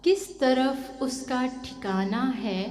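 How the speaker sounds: slow, drawn-out mushaira couplet; ghazal recitation. Acted. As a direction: stretch the verse out for the listeners.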